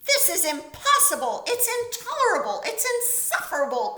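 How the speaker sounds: spluttering with fury and disbelief; angry outburst. A narrator's reading. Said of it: "cried Bear, shaking with anger".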